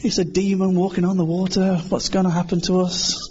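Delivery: high-pitched mock panic; freaking out. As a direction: act out panicking disciples. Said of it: "they were all freaking out".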